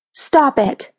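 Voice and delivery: understated alarmed cry. A narrator's reading. Girl ordering her friend to stop, crying out mildly. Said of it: "Lisa cried out".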